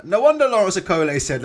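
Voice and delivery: normal talking voice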